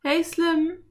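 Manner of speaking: high-pitched call for attention; light, high girl's call. A narrator's reading. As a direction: call over to the cowboy as a girl. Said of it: "rief sie".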